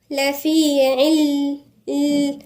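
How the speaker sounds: slow, melodic tajweed chant; measured Quranic recitation. Acted. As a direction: recite the verse slowly, drawing out the vowels. Recites slowly.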